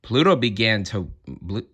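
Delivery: ordinary delivery, nothing marked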